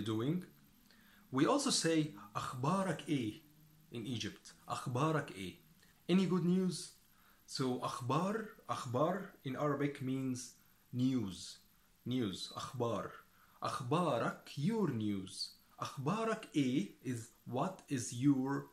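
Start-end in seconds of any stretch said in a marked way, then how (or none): none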